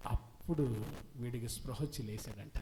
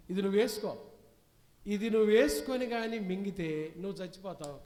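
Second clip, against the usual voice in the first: loud, pointed offer; 5 semitones higher, 6 dB louder than usual. Acted. Professor handing mother the tablet.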